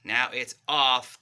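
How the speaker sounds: "off" loud and drawn out, slow; stress on off. Shown as a demonstration. Parent showing pizza off the plate, slow stress on "off". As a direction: stretch "off" slowly for the child. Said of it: "Make sure that your word matches the action".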